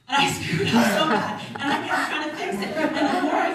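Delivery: giggling at her own blunder; loud embarrassed laughter while telling the slip; laughing. Real, not acted.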